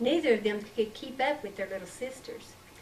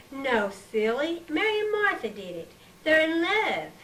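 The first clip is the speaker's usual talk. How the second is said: teasing; 6 semitones higher, 4 dB louder than usual. Acted. Teasing little sister.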